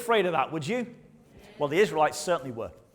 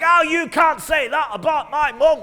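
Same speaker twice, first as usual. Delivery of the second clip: high, loud mock outrage; angry shout; 10 semitones higher, 11 dB louder than usual. You, provoked by an insult to your mum, shouting back. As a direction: yell it like someone who has just been insulted. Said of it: "and you're like".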